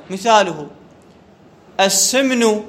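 ordinary talk

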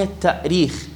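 "التأريخ" with a glottal stop, "ta'-reekh"; still hamza sounded. Shown as a demonstration. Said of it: "والهمزة ساكنة". (نطق «التأريخ» بهمزة ساكنة)

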